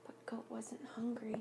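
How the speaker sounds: sad hushed whisper. A narrator's reading. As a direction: whisper it softly and sadly. Sad storybook line.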